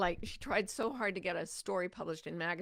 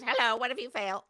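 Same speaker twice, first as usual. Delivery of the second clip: mock nagging fear; 4 semitones higher, 7 dB louder than usual. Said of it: "the little pop-up fears".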